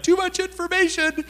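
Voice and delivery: very high, comic squeal; mock overwhelmed squeal. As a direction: squeak it out as if swamped. Mock reaction to her confession.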